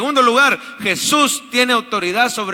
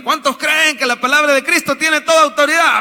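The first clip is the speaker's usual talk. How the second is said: higher, louder mock question to the church, stretching "toda"; mock rally question; 8 semitones higher, 5 dB louder than usual. Shown as a demonstration. Pastor mimicking a question to the congregation, higher and louder. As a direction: call it out like a preacher asking for amens. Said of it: "que yo pregunte ahorita".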